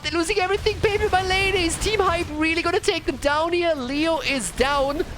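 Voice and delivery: high-pitched, urgent game call; excited play-by-play. Real, not acted. Caster sees the team losing everything, high excited call.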